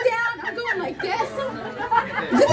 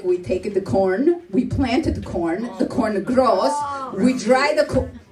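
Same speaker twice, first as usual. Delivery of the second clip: low, slow, step-by-step explaining; mock recipe recital, low and drawn out; imitated mother; 8 semitones lower than usual. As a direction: voice a patient old-country mother, slowly.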